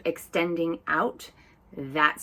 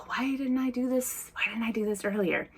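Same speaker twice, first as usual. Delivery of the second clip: higher, softer mock lament; playful self-mockery; 5 semitones higher, 4 dB quieter than usual. Poking fun at herself for not resting sooner, playful self-mockery. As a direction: make fun of yourself, lightly incredulous. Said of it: "make a little bit of fun about yourself".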